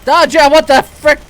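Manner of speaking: loud high startled shout; startled yell. Real, not acted. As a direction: yell it, caught off guard. Startled by enemy in game.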